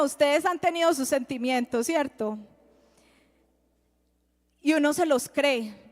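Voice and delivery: ordinary talk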